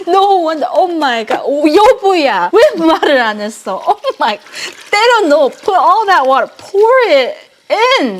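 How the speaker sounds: loud, high shocked outburst. Real, not acted. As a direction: gasp at the salty broth. Shocked to learn broth concentrate.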